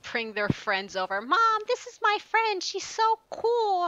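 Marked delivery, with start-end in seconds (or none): 1.2-3.9 put-on high, drawn-out gushing teen voice; mock teen daughter. Mimicking her kid bragging to mom about a cool friend, high gushing teen voice. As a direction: gush like a starstruck teenager. Kids voiced in retold scene.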